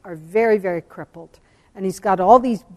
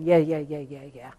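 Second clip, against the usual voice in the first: dismissive; 5 semitones lower than usual. Retold or imitated. Speaker showing how she might have ignored God's voice, dismissive. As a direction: imitate someone half-listening, waving it off in a low voice. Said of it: "Instead of saying".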